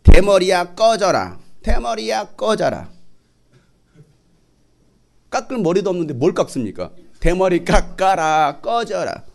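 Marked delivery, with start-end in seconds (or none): 0.0-2.9 loud mocking chant, bald one get lost; jeering yell. Preacher voicing kids yelling insults at Elijah, loud jeer. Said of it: "이렇게 비웃은 거예요". 7.2-9.2 slow, drawn-out playground chant; sing-song jeering at the bald prophet; mocking kids' taunt. Preacher voicing children taunting Elijah, slow mocking chant. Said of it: "이렇게 비웃은 거예요".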